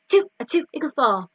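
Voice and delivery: quick rattled-off sum. Shown as a demonstration. Teacher speeding up the sum for children. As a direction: say it fast as you can. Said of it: "Can you go faster?".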